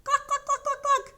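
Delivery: loud, high, rapid-fire clucks. Pretend. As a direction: cluck frantically like hens over the wind. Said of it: "The hens clucked louder".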